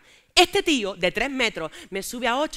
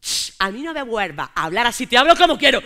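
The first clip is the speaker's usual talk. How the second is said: grito fuerte y rabioso; grito furioso; 2 semitones higher, 9 dB louder than usual. Acted. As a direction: revienta de rabia en la última frase. Pelea de pareja acalorada. (discusión de pareja, grito furioso)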